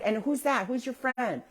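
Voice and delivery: put-on high, friendly questions to a toddler; talking to small child. Adult asks child about friend, child-directed voice. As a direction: coax a little one in high voice.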